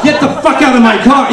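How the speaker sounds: loud, high imagined yell; mock angry yell. Stranger who might have yelled him out of her car, mock yell. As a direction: bark the stranger's expected outburst.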